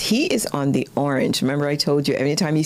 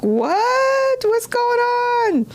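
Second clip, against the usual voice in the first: high, drawn-out mock astonishment; startled fish; 3 dB louder, much slower than usual. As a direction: squeal it like a bewildered cartoon fish. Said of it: "the fish is like".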